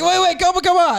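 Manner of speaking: high, hurried retold plea; imitating a stranger begging him to stay; urgent calling back. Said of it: "He's like".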